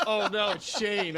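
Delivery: mock dismay. Dreamer spotting his friend jogging behind him, mock dismay. Caught running shirtless in dream.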